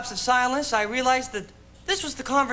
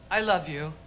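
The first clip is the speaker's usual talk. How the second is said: low, gentle declaration of love; tender plea; 6 semitones lower, 3 dB quieter than usual. Acted. Man to the woman who moved away, tenderly. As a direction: say it softly and simply.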